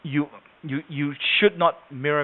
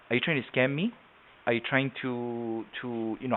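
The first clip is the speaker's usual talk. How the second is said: softer, hesitant questioning; slow talker; 9 dB quieter than usual. Acted. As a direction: imitate a wary slow talker. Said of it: "People that talk slow".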